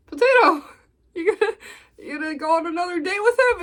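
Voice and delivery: high, quick giggly tease; teasing with a laugh. Retold or imitated. Teasing Potato about a second date, high and giggling.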